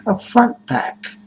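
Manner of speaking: "a front pack" slow and high, front stressed. Shown as a demonstration. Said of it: "not a backpack".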